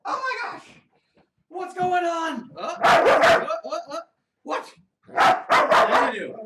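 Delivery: high, loud mock panic to excite the dog; squealing hammed-up alarm. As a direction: pretend to freak out for the dog. Hyping dog into frenzy.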